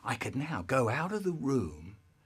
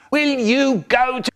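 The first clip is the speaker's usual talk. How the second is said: loud, high shouted order, cut short; brother shouting; 12 semitones higher, 12 dB louder than usual. Retold or imitated. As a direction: yell it but drop the last word. Brother ordering him to bed.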